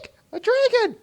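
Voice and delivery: high, loud scared shriek; frightened shriek. Acted. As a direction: shriek it at the sight of a dragon. Pony shrieks on seeing dragon.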